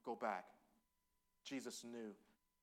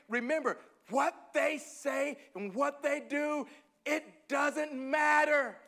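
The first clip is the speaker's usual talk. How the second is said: higher and louder, slow insistent preaching; fervent preaching; 9 semitones higher, 5 dB louder than usual. Acted. Preacher urging Ketrick that what people say doesn't matter, insistent. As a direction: press each line home.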